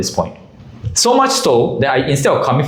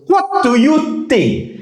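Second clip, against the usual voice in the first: high, slow disbelieving question; stunned he'd even ask; incredulous; much slower than usual. Retold or imitated. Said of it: "and then goes".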